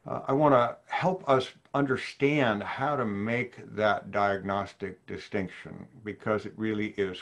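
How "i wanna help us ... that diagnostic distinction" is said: ordinary delivery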